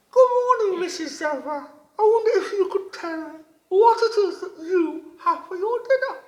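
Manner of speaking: high, loud, bright-eyed little frog voice; wide-mouthed frog voice. A narrator's reading. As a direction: squeak it out like an eager little frog. Frog greets the zebra.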